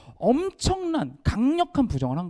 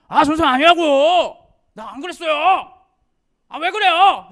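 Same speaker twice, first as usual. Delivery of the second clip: put-on indignant teen protest; 10 semitones higher, 9 dB louder than usual. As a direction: protest hard like a wrongly accused teen. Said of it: "이렇게 했으면". (억울해하며 크고 높게 항변하는 학생)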